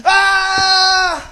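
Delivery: long, high, loud scream; full-volume acted yell of alarm; sudden scream. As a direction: scream as the camera slips away. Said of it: "Biglang sumigaw ho yung cameraman".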